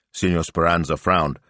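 ordinary delivery

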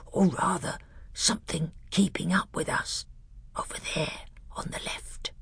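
soft, hushed warning; low voice. Acted. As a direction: keep it low and tense. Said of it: "he said in a low voice".

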